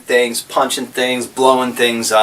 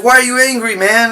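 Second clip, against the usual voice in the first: higher, puzzled question; Golu asking; 9 semitones higher, faster than usual. Pretend. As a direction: ask him like a worried friend. Golu asks clone.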